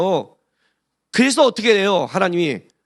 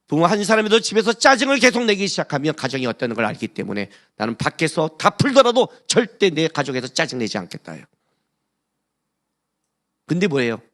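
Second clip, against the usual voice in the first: ordinary delivery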